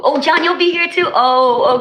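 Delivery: thrilled someone is coming; excited surprise at a guest; surprised. Real, not acted. Surprised at John.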